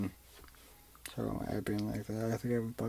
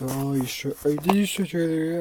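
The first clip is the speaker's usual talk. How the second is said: high, loud, pressing question; dad's raised voice; 6 semitones higher, 9 dB louder than usual. Retold or imitated. Dad grilling his son about the ear gauge, loud and high. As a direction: mimic dad pushing him hard.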